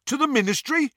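high, questioning henchman; eager snatcher. A narrator's reading. Snatcher to Greyback, high eager question. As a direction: ask it hopefully.

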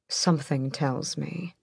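breathy murmur. A narrator's reading. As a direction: murmur it softly, close. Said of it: "his breath skittering along her skin".

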